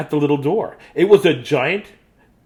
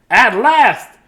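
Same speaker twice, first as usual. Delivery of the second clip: high, loud exclamation; triumphant relief; 9 semitones higher, 11 dB louder than usual. A narrator's reading. Dudley alone in his own house, loudly triumphant. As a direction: cry it out in delighted relief.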